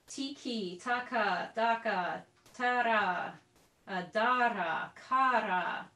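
each paired syllable spoken crisply and separately; articulation syllables. Shown as a demonstration. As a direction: demo Ganassi's tonguing syllables one by one. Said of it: "Ganassi paired his articulations".

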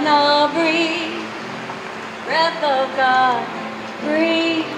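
normal talking voice

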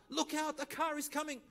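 high, sharp cry of warning to a pedestrian; warning shout. Retold or imitated. Someone on the road shouts a warning at a man crossing, loud and high.

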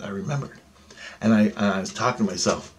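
usual speaking voice

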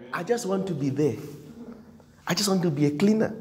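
quick, soft, low little voice; mock timid mumble. Preacher mimicking someone settling for little, soft and quick. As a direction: toss it off like a shrug.

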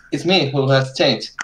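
ordinary delivery

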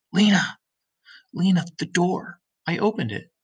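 excited call. Acted. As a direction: call out breathless with news. Lizzie bursting in excited.

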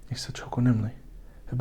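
hushed whisper for emphasis. Real, not acted. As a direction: whisper it reverently. Solemn point about Jesus.

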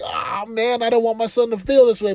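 pleading for his son; sudden high heartfelt worry; pained inner voice. Retold or imitated. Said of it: "there's a piece of me that's like".

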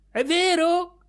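high, loud disbelieving question; shocked mother. Acted. Mother asking her son in disbelief, high shocked cry.

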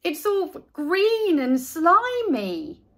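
put-on slow, high disgusted kid; high, slow yuck voice; grossed out. A narrator's reading. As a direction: drag out the disgust. Buster disgusted by lunch.

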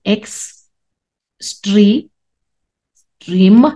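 X, STRE, STREAM with clear gaps between; broken into syllables. Shown as a demonstration. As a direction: sound out X, STRE, STREAM one piece at a time. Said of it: "we break our words into syllables".

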